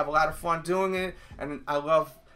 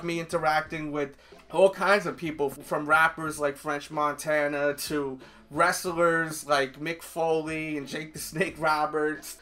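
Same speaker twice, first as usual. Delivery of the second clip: ordinary talk, nothing marked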